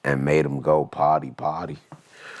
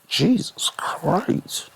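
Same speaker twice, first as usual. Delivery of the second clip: shocked; 11 semitones higher, much slower than usual. Real, not acted. Shocked at lawyer fees.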